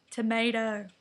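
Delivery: American "tomato", said "tuh-MAY-doh"; American accent. Shown as a demonstration. Immigrant girl practising to fit in, American accent on "tuh-MAY-doh". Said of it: "trying to speak American".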